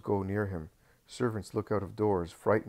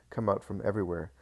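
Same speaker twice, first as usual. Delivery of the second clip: ordinary delivery, nothing marked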